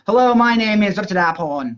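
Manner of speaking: put-on Thai person introducing themselves in accented English. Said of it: "introducing themselves with a Thai accent".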